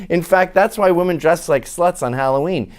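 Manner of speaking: normal talking voice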